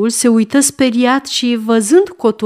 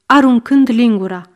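usual speaking voice

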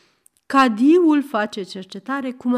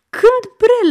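astonished shout; 13 semitones higher, 9 dB louder than usual. Acted. Cadi exclaims.

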